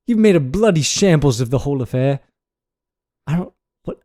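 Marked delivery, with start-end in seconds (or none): 0.1-2.2 put-on British scolding, punchy opening; British accent. Podcaster reads a mock nightly news script, British accent. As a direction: read it as an indignant British newsreader. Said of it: "read in a British accent".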